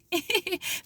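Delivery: short high mock laugh; imitating her laugh. To the listener, mimicking the friend laughing at her: short high laugh. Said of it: "And she's laughing".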